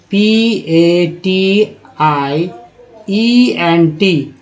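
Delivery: very slow, letter by letter spelling. Shown as a demonstration. Teacher spells the word slowly for students to follow. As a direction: spell P A T I E N T one letter at a time, drawn out. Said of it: "उच्चारण क्या करते हैं".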